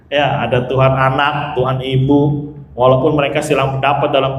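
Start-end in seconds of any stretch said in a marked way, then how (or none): none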